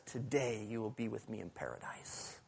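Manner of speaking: a soft, breathy whispered promise; hushed whisper. Retold or imitated. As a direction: whisper it close, like a secret. Jesus answers the thief tenderly.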